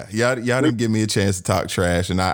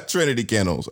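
ordinary talk

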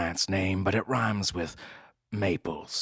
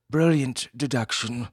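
snooty bored cat; 6 semitones higher, 4 dB louder than usual. Acted. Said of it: "said the cat".

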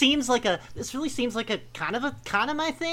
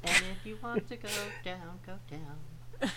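mock hypnotic murmur; 4 semitones lower, 11 dB quieter than usual. Mocking the hypnosis lines quietly.

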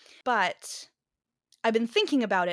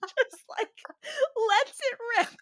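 laughing. Real, not acted. Laughing while telling.

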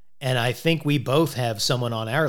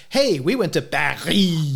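Paris as an exaggerated French "Pa-ree"; hammed-up French ending, drawn out slowly on Paris; showy French pronunciation; 3 semitones higher, slower than usual. Co-host mocking people who show off French, affected French Paris. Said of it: "I don't say".

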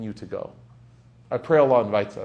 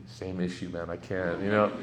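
lower, softer voice of the sheikh; sheikh's lowered voice; 7 semitones lower, 8 dB quieter than usual. Retold or imitated. Sheikh admitting to the preacher he stares too, low and soft. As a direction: voice the sheikh low and matter-of-fact. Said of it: "he used to say to me".

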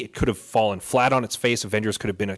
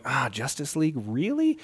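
skeptical; 3 semitones higher, 4 dB quieter than usual. Retold or imitated. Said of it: "I'm going".

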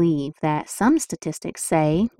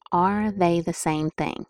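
ordinary talk

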